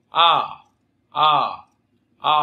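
"à" held with both lips opened wide; loud, sustained open "à"; wide-open vowel. Shown as a demonstration. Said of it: "hai môi mở rộng".

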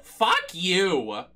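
ordinary delivery

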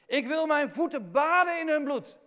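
loud, high cry to the Lord; David crying out. Retold or imitated. David crying out against his enemies, loud and high. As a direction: hurl it at heaven in fury. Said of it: "Letterlijk zegt hij dat".

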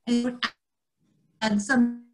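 plain reading voice

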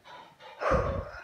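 loud breathy sigh; heavy sigh. Real, not acted. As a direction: let out a big tired sigh. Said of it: "I'm so out of breath".

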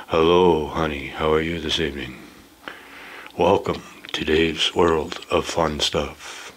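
low smooth radio DJ. Pretend. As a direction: croon it like a late-night DJ. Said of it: "I'll use my best D J voice".

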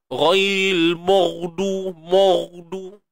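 maghdu said as modu with pushed-out lips; rounded ghain. Shown as a demonstration. Teacher showing the wrong rounded ghain. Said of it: "jangan mo".

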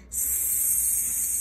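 hissing S drawn out long; drawn-out s like a snake. Shown as a demonstration. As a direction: hiss S like a snake. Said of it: "just like a slithery snake".

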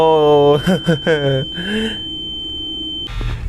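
shrill burst of real laughter; high laugh. Real, not acted. Streamer laughing hard at his own game.

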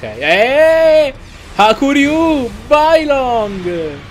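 high, loud, drawn-out battle cry; hyped attack-name shout. Pretend. Fan calling out the move during the film, excited shout. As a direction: belt it out, stretching the last word long.

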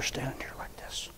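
hushed whisper. Real, not acted. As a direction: drop to a breath. Storyteller drops to whisper.

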